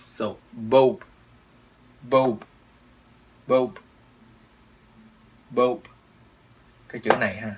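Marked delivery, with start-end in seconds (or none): 0.5-5.8 "boat" said singly with a careful b; clear B sound. Shown as a demonstration. Teacher drills learners to be understood, "boat" said distinctly. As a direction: pronounce each "boat" distinctly, minding the consonant. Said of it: "Mình đọc rõ chữ B".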